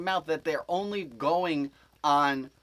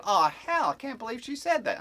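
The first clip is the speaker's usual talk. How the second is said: high, loud mock disbelief; incredulous exclamation; 8 semitones higher, 5 dB louder than usual. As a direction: exclaim it like retold shocked gossip. Retells own exclamation of disbelief.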